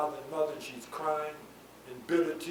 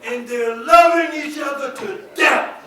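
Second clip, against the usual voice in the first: high, ringing pulpit shout; loud preacher's climax; full-force preaching; 10 semitones higher, 13 dB louder than usual. Real, not acted. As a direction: cry it out to the whole congregation.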